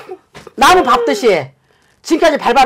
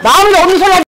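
"마음에도 없는 소리 하지" thrown back higher, leaning on 마음에도; angry scolding; 7 semitones higher, 4 dB louder, much faster than usual. Acted. Shaman throwing the husband's fake apology back at him, angry. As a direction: hammer the accusation home.

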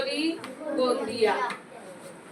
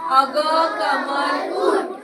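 drawn-out lead line for the class to echo; teacher leading the class in slow repetition; slow call and repeat; 4 dB louder, much slower than usual. Shown as a demonstration. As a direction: say it slowly so the children can follow. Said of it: "बोला माझ्या मागून".

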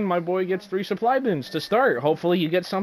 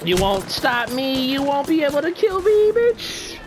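taunting; 7 semitones higher than usual. Pretend. Taunting enemies while fleeing.

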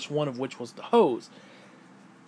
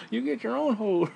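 mock retort; 8 semitones higher than usual. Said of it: "And I went".

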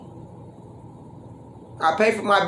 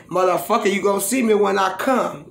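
usual speaking voice

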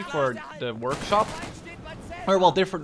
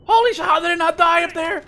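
much higher, louder hyped yelling; wild excitement; 10 dB louder than usual. Real, not acted. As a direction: charge in thrilled. Charging and surviving intense fight.

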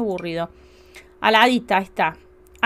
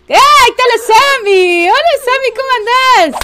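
much higher, louder delighted hello; excited greeting; 12 semitones higher, 12 dB louder than usual. Real, not acted. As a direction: greet her like a long-lost friend. Saluda a su Sim Sammy. (saludo agudo y emocionado a Sammy)

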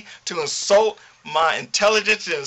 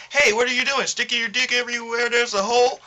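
mocking quote; 5 semitones higher, faster than usual. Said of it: "say some random shit like".

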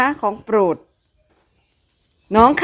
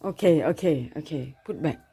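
slow low calming okays; 8 semitones lower, 10 dB quieter, slower than usual. Real, not acted. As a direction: settle the dogs down gently. Calming excited dogs.

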